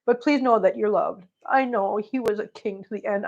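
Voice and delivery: ordinary talk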